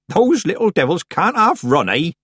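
loud, high country-woman voice; amused, high and loud; jovial Nanny Ogg figure. A narrator's reading. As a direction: crow it like a hearty old village witch.

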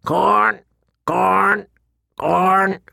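high, repeated bird cries; shrieking raven. A narrator's reading. Raven demands food in the solar, shrieking bird. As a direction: caw each word separately, high-pitched. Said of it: "the bird shrieked".

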